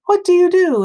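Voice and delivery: high, loud frightened question; alarmed demand. A narrator's reading. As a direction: fire it at him in alarm. Sailors question Jonah.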